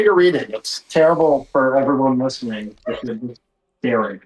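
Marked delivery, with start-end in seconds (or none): none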